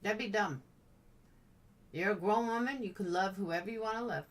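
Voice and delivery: ordinary delivery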